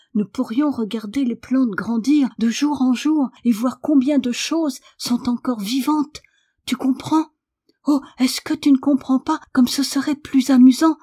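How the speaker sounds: high, urgent excitement; emotional, eager pleading. A narrator's reading. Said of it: "son émotion".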